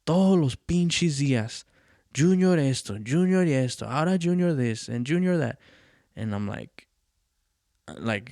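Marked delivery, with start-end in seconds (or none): 0.1-5.6 hammed-up angry father ranting; loud mock tirade from the drunk father; drunk dad's rant. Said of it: "He's all".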